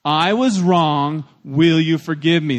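slow, loud group recitation lead. Shown as a demonstration. Pastor leads everybody who has sinned, loud and slow. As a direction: say it loud and slow so a crowd can join in. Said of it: "say these words with me out loud".

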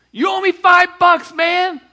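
a high, furious yell; angry shout. A narrator's reading. Ungrateful servant shouts at the man who owes five bucks. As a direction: bellow it as if throttling him. Servant choking his debtor.

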